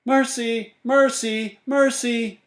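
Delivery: high loud calling out; loud high repeated calls; shouting. Acted. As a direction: call her name across the lot.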